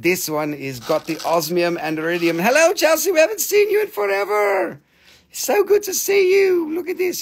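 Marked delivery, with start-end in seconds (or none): 2.4-7.2 high-pitched delighted hello; excited greeting. Real, not acted. Seller greets Chelsea back after forever, excited greeting. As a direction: squeal a welcome to a long-lost regular.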